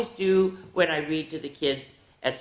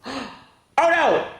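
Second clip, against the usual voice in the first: gasping mock fright shouting to the kids; playfully scare the class with a big fake gasp; 9 semitones higher, 5 dB louder, slower than usual. Shown as a demonstration. Storyteller startles the kids at the final page with mock horror. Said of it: "I stand up and I go".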